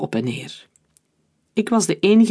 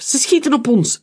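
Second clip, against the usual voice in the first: high-pitched urgent call; alarmed call; 9 semitones higher, 4 dB louder than usual. A narrator's reading. Omer warns his friends of gunfire, alarmed call. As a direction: call out a warning under fire. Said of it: "riep Omer".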